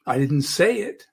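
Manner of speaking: "say" lifted and held; stress on SAY. Shown as a demonstration. Coach hinting it may have been implied, stress on "say". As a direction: lean on the word "say". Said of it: "I may have implied it".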